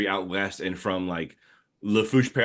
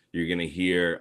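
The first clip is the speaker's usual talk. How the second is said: usual speaking voice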